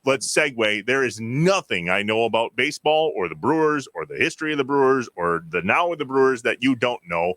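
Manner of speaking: ordinary delivery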